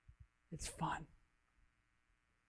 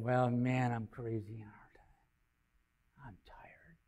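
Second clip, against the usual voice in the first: tired self-talk; 5 semitones lower, 5 dB quieter, much slower than usual. Retold or imitated. Talking himself out of it.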